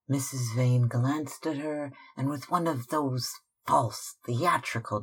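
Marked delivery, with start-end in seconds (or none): none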